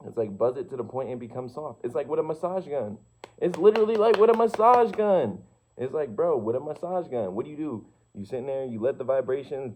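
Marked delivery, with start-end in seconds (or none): 3.4-5.4 much higher and louder, bursting with excitement; excited shout. Real, not acted. To the viewer about vibration, an excited shout. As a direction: belt it out like a revelation.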